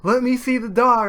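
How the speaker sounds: high, loud insistent mom; pushy mom. Retold or imitated. Mom reaching for the phone, high and insistent.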